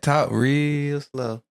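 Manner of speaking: slow, stretched-out lyric quote; real slow. Retold or imitated. Quoting a favourite lyric to co-host, real slow. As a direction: draw out the words lazily. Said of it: "I like that because it said".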